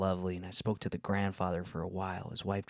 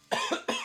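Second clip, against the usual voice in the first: short dry cough; a quick cough mid-sentence; coughing fit. Real, not acted. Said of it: "So sorry about the coughing".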